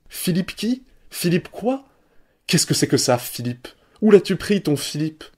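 put-on high jeering kids' voices; mocking schoolboys. A narrator's reading. Schoolboys jeering at Simon's new papa, high mocking voices. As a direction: taunt him like a pack of children.

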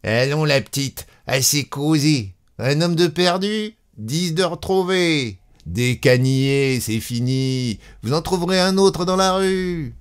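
rude, high, jeering conductor; coarse conductor. A narrator's reading. Conductor rudely dismissing a woman who lost her man, coarse. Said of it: "Le conducteur devenait grossier".

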